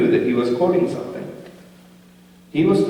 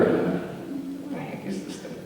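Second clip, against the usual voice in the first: puzzled whisper; 7 semitones higher, 9 dB quieter than usual. Retold or imitated. Disgusted kid at sweater.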